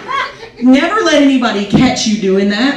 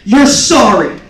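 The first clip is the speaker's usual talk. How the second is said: louder, slow, flat echo of his words; loud repeating; 6 dB louder, much slower than usual. Shown as a demonstration. Wife winning a fight, repeating his words back loudly. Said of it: "repeating ... loudly".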